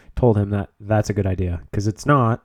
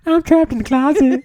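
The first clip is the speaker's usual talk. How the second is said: high, laughing singsong imitation; R. Kelly impression; 3 dB louder than usual. Teasing a friend with an R. Kelly impression, high and laughing. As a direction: mimic the song line while laughing. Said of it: "you sound like R. Kelly".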